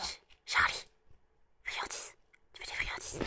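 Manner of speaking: chuchote au chat; chuchoté. Real, not acted. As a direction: murmure doucement pour le chat. (chuchote au chat pour lui proposer des friandises)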